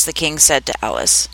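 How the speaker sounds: usual speaking voice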